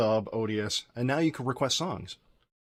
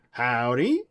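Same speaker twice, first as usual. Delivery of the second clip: put-on Texan accent; 5 dB louder than usual. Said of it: "he's from Texas".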